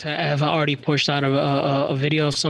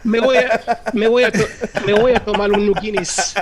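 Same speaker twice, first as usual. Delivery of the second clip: put-on a slightly raised, jokey Irish Spanish accent; 4 semitones higher, 4 dB louder than usual. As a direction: order the Guinness like an Irish Spaniard. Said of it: "I could try to do an Irish Spanish accent".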